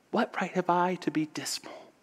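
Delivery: high, hushed near-whisper; saying to himself. Acted. Orphan boy thinking of others worse off, hushed to himself. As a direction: ask yourself softly, voice thinning to breath. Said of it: "saying to himself".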